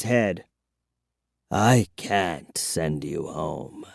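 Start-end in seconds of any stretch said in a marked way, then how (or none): 1.5-3.9 very soft, slow, lower; vampire whisper. A narrator's reading. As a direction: hush it like a weary old vampire. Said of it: "he whispered".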